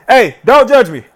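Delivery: ordinary delivery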